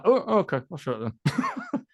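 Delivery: laughing reply. Retold or imitated. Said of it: "So I was like".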